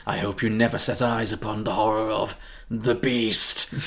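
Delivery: slow, ominous vampire impression; melodramatic vampire. Vampire warning of his great nemesis, slow melodramatic impression. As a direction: draw it out with mock dread.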